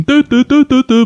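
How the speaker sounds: high repeated "dø" noises; mock someone poking at a keyboard; imitated computer tapping. S0 imitates David på computeren, high mock tapping. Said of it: "så sidder David bare sådan".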